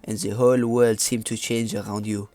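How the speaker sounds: put-on French accent, narrating. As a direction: read it out in a mock French accent. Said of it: "I'm gonna stop doing my French accent now".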